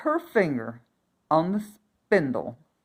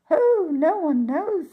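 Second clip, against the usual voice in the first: good fairies; 11 semitones higher, 6 dB louder than usual. A narrator's reading. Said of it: "said the three women".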